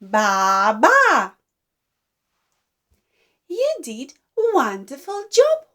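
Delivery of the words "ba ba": sheep bleat. Shown as a demonstration.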